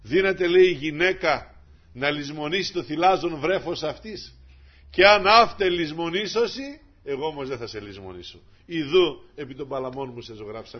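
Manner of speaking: loud, rising sermon voice reading scripture; preaching at full force. Acted. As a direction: proclaim the verse to the church. (δυνατό κήρυγμα)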